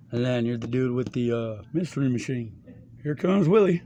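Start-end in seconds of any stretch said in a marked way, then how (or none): 3.0-3.8 louder, higher hail of a friend arriving; calling out. Retold or imitated. Imitating people greeting Willie's mystery machine, loud hail. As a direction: call out his arrival with gusto.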